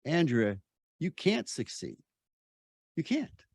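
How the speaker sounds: a hint of a dismissive mother; lightly voiced mom saying you can't; mother's put-down. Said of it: "and Mom's saying".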